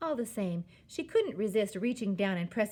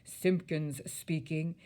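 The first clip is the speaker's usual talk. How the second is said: pompous, drawn-out phone greeting; lightly puffed-up father on the phone; self-important telephone voice; 2 semitones lower, slower than usual. A narrator's reading. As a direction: answer the phone slowly, full of importance. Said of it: "in his very important telephone".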